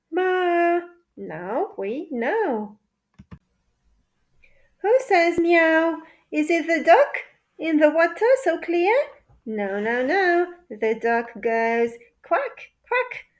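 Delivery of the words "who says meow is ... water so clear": usual speaking voice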